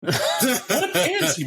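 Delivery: high and slow, laughing through "pansy"; laughing repeat. Retold or imitated. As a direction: say it again, cracking up.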